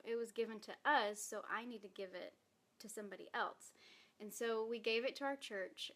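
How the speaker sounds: usual speaking voice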